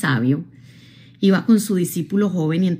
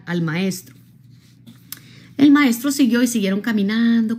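ordinary talk

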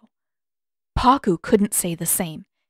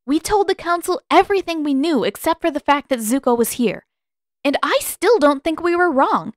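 high, loud and determined; defiant; 8 semitones higher, 5 dB louder than usual. A narrator's reading. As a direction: jaw set, rushing the middle. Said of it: "She set her jaw".

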